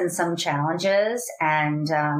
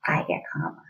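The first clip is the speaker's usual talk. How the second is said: unvoiced low whisper; 14 semitones lower, 4 dB quieter than usual. Shown as a demonstration. Parent calming herself down, in a whisper. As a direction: whisper it to yourself to calm down. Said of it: "if I start to whisper".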